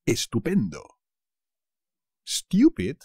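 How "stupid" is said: normal talking voice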